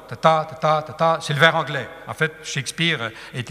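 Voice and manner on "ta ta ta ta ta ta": "ta ta" short-long, pushed on the second; iambic beat. Shown as a demonstration. Teacher likens it to English verse, beaten iambic rhythm. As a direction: drum out light-HEAVY pairs. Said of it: "un court, un long".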